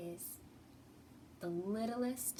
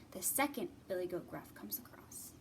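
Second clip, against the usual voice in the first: whisper; 3 semitones lower, 5 dB quieter than usual. Acted. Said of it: "whispered narration".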